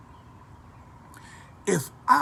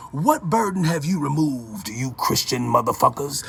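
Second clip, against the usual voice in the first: normal talking voice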